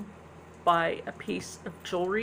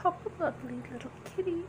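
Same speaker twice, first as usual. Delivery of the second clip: put-on much higher, fast cooing baby voice; cutesy baby talk; 10 semitones higher, 3 dB quieter, much faster than usual. Vlogger cooing at viewers to help a kitten. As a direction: coo it like talking to a kitten.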